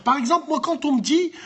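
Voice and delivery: usual speaking voice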